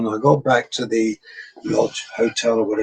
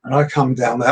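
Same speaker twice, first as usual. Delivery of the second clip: normal talking voice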